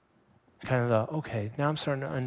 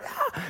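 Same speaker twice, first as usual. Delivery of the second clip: playful squeal. Retold or imitated. Child jumps out shouting.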